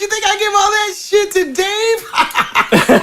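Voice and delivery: very high, drawn-out character voice; generous Tom. Acted. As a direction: call out high to the kids. Player voices Tom to kids.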